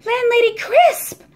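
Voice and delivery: high, loud shocked cry of her name; boy crying out at the for-sale sign; alarmed cry. A narrator's reading. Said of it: "I cry".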